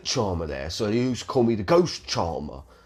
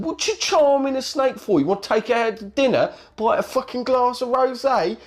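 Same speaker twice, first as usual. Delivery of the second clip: high-pitched tirade of scornful questions; acted-out heckling, pitched way up; incredulous mocking rant; 11 semitones higher than usual. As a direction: pile on the questions in disbelief. Acted mocking rant at snake charmer.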